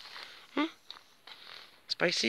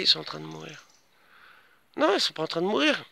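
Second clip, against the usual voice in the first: normal talking voice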